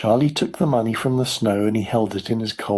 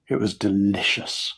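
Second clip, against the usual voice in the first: usual speaking voice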